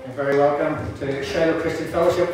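normal talking voice

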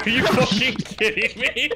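laughing in disbelief; cracking up mid-question. Real, not acted. Teammate's absurd chat message.

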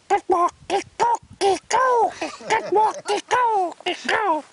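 very high, slow squawked syllables; imitated bird call. Shown as a demonstration. Teacher showing a bird's sound, high slow squawk.

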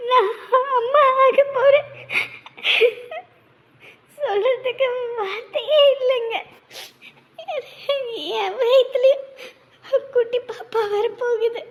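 slow, crying with joy; words catching in happy sobs; happy tears. Acted. Wife tells husband she'll be a mother, crying with joy. Wife learns she is pregnant.

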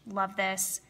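ordinary talk, nothing marked

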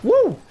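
high sudden whoop of surprise; startled whoop. Real, not acted. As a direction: let out a loud yelp. In-game close call.

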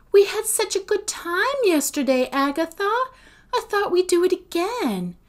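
very high, sugary wheedling; sweet coaxing. A narrator's reading. Sophie tries to win Agatha round, sweetly wheedling.

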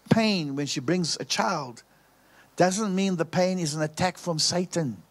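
ordinary talk, nothing marked

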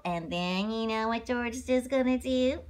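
plain reading voice

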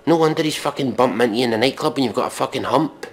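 normal talking voice

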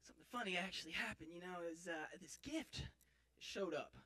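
ordinary talk, nothing marked

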